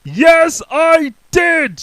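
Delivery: loud high shout; very top of his voice. Acted. Mister Noisy shouting at the butcher. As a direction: bellow it. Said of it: "shouted Mister Noisy at the very top".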